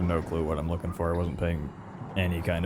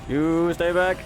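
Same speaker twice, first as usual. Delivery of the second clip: shouting; 8 semitones higher, 5 dB louder, much slower than usual. Real, not acted. Threatening a monster in game.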